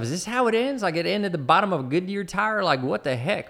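high-pitched, incredulous inner protest; indignant thought, pitched well up. Retold or imitated. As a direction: act out disbelief at how it ends. Acted thought of a disbelieving protest.

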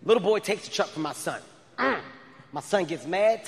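ordinary talk, nothing marked

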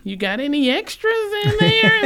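hopeful in-law angling for leftovers; begging brother-in-law. Retold or imitated. Brother-in-law asking Mel for spare cake, pleading. House visitors voiced begging.

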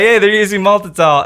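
loud. Retold or imitated. Quoting angry online commenters.

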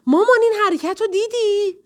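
put-on high, loud boyish yell over the match; excited child's shout. A narrator's reading. Son shouting to his mother during the football game, excited yell. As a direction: call out to mom, thrilled by the play. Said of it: "فریاد کشید".

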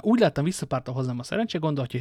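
usual speaking voice

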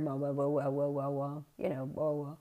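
low, soft garbled wah wah; a lightly voiced muffled dad mumbling down the line; imitated mumbling. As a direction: mimic dad's mumble through static. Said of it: "you heard this mumbling".

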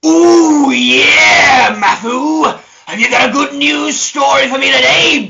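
loud, high wrestler shout; booming wrestler boasts. Acted. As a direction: boom it out like a wrestling promo. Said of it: "I'll do it in my best wrestler voice".

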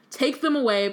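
a judge roaring the order, modestly voiced; read-aloud roar. A narrator's reading. Crouch orders the prisoners removed, a hammed-up roar. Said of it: "Crouch roared".

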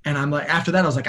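ordinary talk, nothing marked

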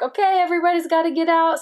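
high, loud, rushed retold command; urgent order. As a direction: imitate staff urgently clearing a room. Medical team clearing the room urgently.

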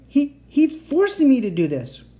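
high, loud stammered accusation; screaming. A narrator's reading. Captive child appeals to the boy, screaming. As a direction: scream it out in panic. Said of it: "I screamed".

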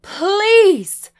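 very loud, high drawn-out wail; shrieked, desperate begging; wailing plea. A narrator's reading. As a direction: cry it out in desperation. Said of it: "my mother wailed".

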